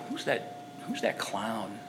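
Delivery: low, slow mutter under the breath; muttered inner thought. Retold or imitated. A man sizing up a stranger, muttering quietly. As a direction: sneer it quietly to yourself.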